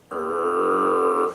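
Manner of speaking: low, sustained imitated growl; monster growl. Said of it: "somebody's growling on the radio".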